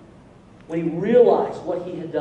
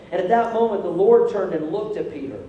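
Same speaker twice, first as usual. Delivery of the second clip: ordinary delivery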